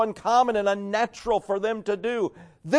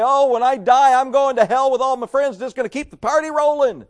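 higher, louder, breezy carefree boast; mocking careless sinner; 6 semitones higher, 5 dB louder than usual. Preacher mocking people who joke about hell, higher and louder. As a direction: parody a cocky partygoer shrugging off hell. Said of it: "there'll be people that'll say".

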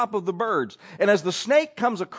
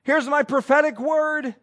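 mock self-important prophet, a touch higher and louder; mock false prophet; 6 semitones higher, 4 dB louder than usual. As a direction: lightly parody a showy prophet's claim. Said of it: "You go saying".

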